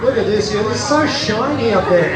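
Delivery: ordinary talk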